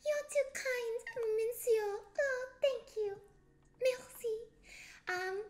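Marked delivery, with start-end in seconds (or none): 0.0-4.3 put-on high-pitched, playful French accent; light, high French-accented voice. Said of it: "Oh no, now my other accent".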